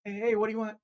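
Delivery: high, jumpy little fish voice; nervous little fish. Acted. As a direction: peek out wary and skittish. Timid fish answers.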